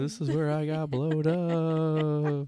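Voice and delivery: slow, drawn-out spooky ghost voice. Pretend. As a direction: moan it out like a dazed ghost. Voicing the ghost chambermaid.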